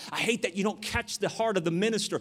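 ordinary talk

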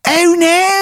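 very high-pitched cartoon character voice; Shithead Steve. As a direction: squeal it out in a put-on falsetto. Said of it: "you just hear him just go".

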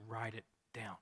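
low, hushed instruction; understated firm command; quiet order. Acted. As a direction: calmly tell the scribe, softly. Acts Matthew calmly ordering scribe.

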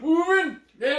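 very high funk-singer squeal; high, punchy soul exclamations. Acted. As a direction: yelp it like a soul showman. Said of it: "You do sound like [name]".

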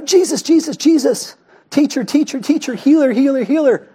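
high, insistent repeated calls; acted high-pitched pleading for attention; clamouring crowd. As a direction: pester him like a needy crowd. Acting out crowd pestering Jesus.

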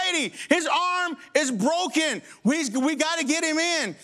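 high, slow, insistent demand; insist, getting upset; upset plea. Retold or imitated. Pastor to the clerk, high insistent and upset.

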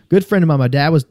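usual speaking voice

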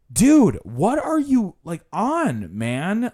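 hammed-up exasperation, pitched high at the start; mock exasperated. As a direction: protest like a fed-up tour passenger. Acted exasperated reaction to the guide.

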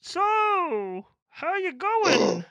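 high, slow, hollow pipe voice; through a tube. Pretend. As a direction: keep speaking down the tube. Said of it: "This is my through a tube voice".

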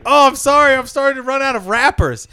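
hammed-up embarrassed superhero; apologetic hero. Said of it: "and he's like".

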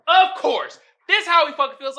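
loud, high excited commentary; hyped up explaining the joke. Real, not acted. Excited about meme.

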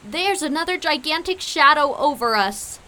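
high, loud alarm; panicked storybook yell; frightened scream. A narrator's reading. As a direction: scream out a scary sighting. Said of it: "Rodney screamed as he shook".

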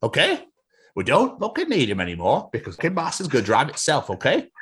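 exasperated, high-swinging Yorkshire rant; Yorkshire boss. Acted. Boss forbidding Jeff to question him, indignant Yorkshire accent. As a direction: pull rank in emphatic Yorkshire. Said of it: "has been built in Yorkshire".

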